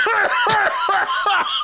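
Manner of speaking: amused high-pitched laugh. Real, not acted. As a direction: laugh along high and free. Cohost laughing at warning.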